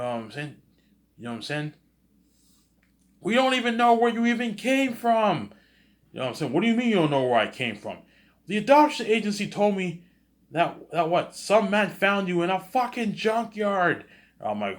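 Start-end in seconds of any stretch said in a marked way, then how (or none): 3.2-5.5 very high, loud mocking parent voice; shrill scolding parent. Parents disowning adopted son, high shrill scolding. 6.5-14.0 very high, loud mocking parent voice; shrill scolding parent. Parents disowning adopted son, high shrill scolding.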